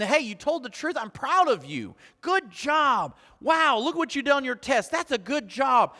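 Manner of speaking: put-on excited parent praising a child; bright praise. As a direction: cheer the kid on.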